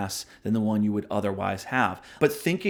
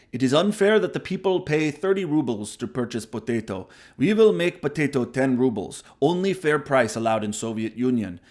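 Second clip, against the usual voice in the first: hammed-up Russian accent, pitched up and pushed; horrible Russian accent; 2 semitones higher than usual. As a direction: play a Soviet planner in a bad Russian accent. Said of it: "That's a horrible Russian accent".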